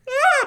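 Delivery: high loud shocked yelp; startled yelp. Retold or imitated. Friend acting out the husband's yelp, high startled cry. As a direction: yelp in surprise. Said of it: "And you're like".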